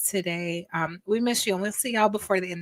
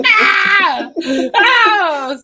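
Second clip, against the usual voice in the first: high shriek of laughter; hiccup giggles; 12 semitones higher, 11 dB louder than usual. Real, not acted. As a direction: squeal through the giggles.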